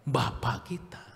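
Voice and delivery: hushed answer with a small rise on bapa; preacher softly answers his own question; whispered answer. Acted. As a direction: drop to a whisper for the reveal.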